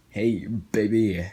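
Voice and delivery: slow, drawn-out flirty come-on; smooth sexy pickup line. Retold or imitated. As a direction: play it like a slick lounge lizard. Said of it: "Well, he didn't sound that sexy".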